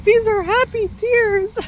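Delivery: put-on high, slow little-boy voice; child voice. Mom imitating her son, high slow child voice. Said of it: "He's like".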